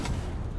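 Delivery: quick breathy gasp of fake shock; playful indrawn gasp; 1 sharp mock gasp. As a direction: gasp as if scandalized. Mock gasp at cheating idea.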